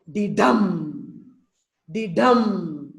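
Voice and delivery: light "the", long stressed "dumb"; iambic beat. Shown as a demonstration. Teacher chants the iamb rhythm for students, weight on dumb. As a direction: lean on dumb after a short, unstressed the. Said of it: "Dumb is stressed".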